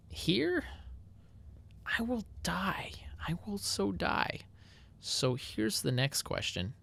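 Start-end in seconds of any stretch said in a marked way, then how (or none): 0.1-4.4 mock doom whisper. Dramatic despair at the trap.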